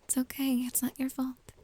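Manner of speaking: normal talking voice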